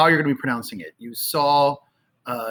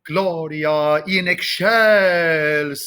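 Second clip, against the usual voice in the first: slow, drawn-out "excelsis", second syllable SHELL swelled; emphasis on shell; much slower than usual. Shown as a demonstration. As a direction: choral chant of "excelsis" leaning hard on ek-SHELL-sis. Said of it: "I'm putting emphasis on shell".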